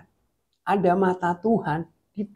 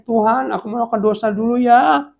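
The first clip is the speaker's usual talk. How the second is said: higher, louder mock confession; openly asking permission, pitched up; candid request; 6 semitones higher, 6 dB louder than usual. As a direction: cheekily announce it to God. Models frank prayer to God.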